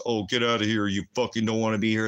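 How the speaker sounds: sneering put-on voice of an American; mocking Americans. Imitating Americans telling him to leave, sneering. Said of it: "you're like".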